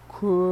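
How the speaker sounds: "crayon" stretched out on a monotone, craaay-ooon; laboured pronunciation. Shown as a demonstration. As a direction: drag "crayon" out as if it's a chore. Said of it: "saying crayon to me is such a freaking chore".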